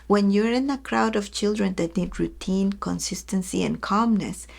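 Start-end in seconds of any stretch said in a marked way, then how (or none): none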